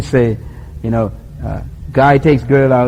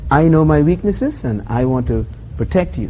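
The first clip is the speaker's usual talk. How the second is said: ordinary talk, nothing marked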